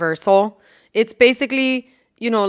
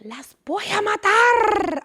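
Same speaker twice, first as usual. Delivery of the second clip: higher, slow mock scolding old lady; scolding grandma; 10 semitones higher, slower than usual. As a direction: nag the kids like a worn-out grandma. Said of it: "bitch at us".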